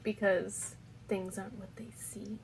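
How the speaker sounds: hushed, secretive teaser; mysterious whisper. Acted. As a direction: whisper it like a cliffhanger. Teaser cliffhanger for next episode.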